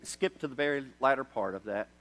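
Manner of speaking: ordinary talk, nothing marked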